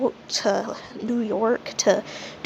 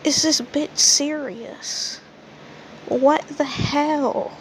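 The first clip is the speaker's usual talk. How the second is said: disbelief; 4 semitones higher, much slower than usual. Retold or imitated. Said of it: "I'm just like".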